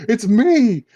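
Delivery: melodramatic realisation. Retold or imitated. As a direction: act out a horrified discovery, hammed up. Voices heroine finding her own corpse.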